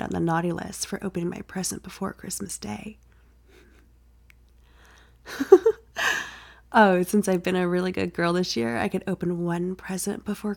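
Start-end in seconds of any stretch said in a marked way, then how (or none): 5.3-5.7 put-on sudden high girlish giggle; loud bright giggle; giggle burst.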